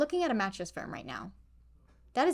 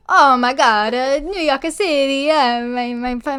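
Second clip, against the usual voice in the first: loud, laughing, hammed-up Italian accent; botched accent sliding into loud cartoon Italian; mock Italian accent; 4 semitones higher, 11 dB louder, slower than usual. Joking with the co-host after a failed Russian try, hammed-up Italian accent. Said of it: "my family is Italian".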